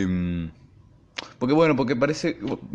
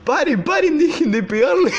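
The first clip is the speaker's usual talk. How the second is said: high, louder squeaky imitation of a pleading vice-principal, laughing through it; mock vice-principal pleading; 5 dB louder than usual. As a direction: ham up the frantic teacher, half laughing. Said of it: "La vicedirectora atrás mío agarrándome la espalda diciendo". (imitación aguda y exagerada de la vicedirectora rogando, entre risas)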